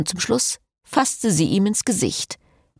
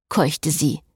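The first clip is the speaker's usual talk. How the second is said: ordinary delivery, nothing marked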